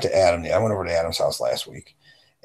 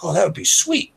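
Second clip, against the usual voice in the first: delighted reply; 10 semitones higher than usual. Retold or imitated. Friend delighted.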